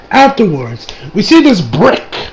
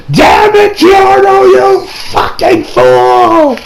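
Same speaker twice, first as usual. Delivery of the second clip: mock furious shout; 11 semitones higher, much slower than usual. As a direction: scream it at him like a cornered villain. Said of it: "He doesn't say any of that".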